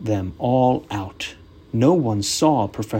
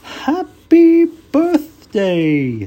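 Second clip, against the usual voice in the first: very high, slow, loud snowman; 8 dB louder, much slower than usual. Acted. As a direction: drawl it like a jolly cartoon snowman. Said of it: "said Frosty again".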